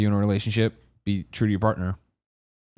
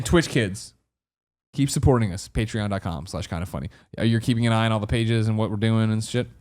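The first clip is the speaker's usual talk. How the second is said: normal talking voice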